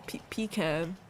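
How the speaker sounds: pecan as PEE-kan, after a false start; insisted pronunciation. Shown as a demonstration. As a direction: stumble on "pe-", then say pecan with "pee" and "can". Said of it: "it just has to be".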